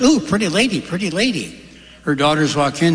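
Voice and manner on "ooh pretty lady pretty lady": high, singsong parrot squawking a compliment. Acted. Brothel-raised parrot greets its new lady owner, high parrot voice. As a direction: coo it high and swooping, like a talking parrot. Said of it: "Parrot goes".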